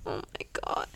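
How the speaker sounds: stunned whisper; retelling a shocked reaction under the breath; hushed shock. Retold or imitated. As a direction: whisper it in disbelief, barely voiced. Retold stunned reaction to the scene.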